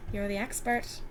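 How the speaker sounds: ordinary delivery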